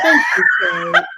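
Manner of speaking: overcome, crying out thanks through tears; tearful cry of thanks. Real, not acted. Thanking Kate for her love, breaking into tears. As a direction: voice breaking high and loud, slow. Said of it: "y'all know I'm crying".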